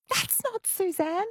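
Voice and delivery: high, drawn-out mock fright; startled listener. Imagined listener hearing a stand-in host, mock startled. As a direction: voice a listener's shocked double take. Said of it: "got a really big fright".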